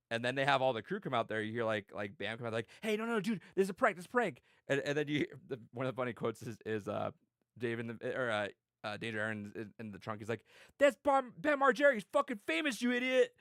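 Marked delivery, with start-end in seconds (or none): 2.8-4.4 higher-pitched hurried protest; retold urgent reassurance. Bam telling the driver it's a prank, high urgent protest. 10.8-13.3 very high, strained mock yell; imitated indignant yelling. Prank victim yelling from the trunk, high indignant shout.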